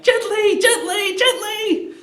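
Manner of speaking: high pleading gently, gently; frantic calming. Retold or imitated. Menenius begging Coriolanus to calm down, high-pitched. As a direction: urge him to calm down, rising high. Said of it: "Menenius is like".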